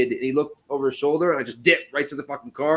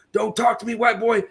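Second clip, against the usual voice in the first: higher-pitched mock threat; retold angry warning; 8 semitones higher than usual. Knife-wielder warning him away, mock angry yell. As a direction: imitate an angry man warning someone off.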